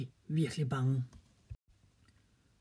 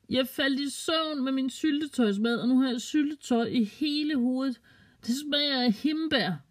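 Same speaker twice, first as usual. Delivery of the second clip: put-on high, slow little girl explaining; giggly girl voice; 9 semitones higher than usual. A narrator's reading. Girl explains jam to her scared parents in a high, slow voice. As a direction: lift the voice high like a giddy child. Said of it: "sådan griner hun".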